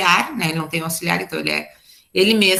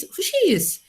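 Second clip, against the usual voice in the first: "Who she is?" with a high rising pitch on "she"; wrong order, sounding odd; 10 semitones higher, slower than usual. Shown as a demonstration. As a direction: ask "Who she is?" high, as a doubtful learner would. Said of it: "vai ficar um pouco estranho". ("Who she is?" bem agudo, soando estranho)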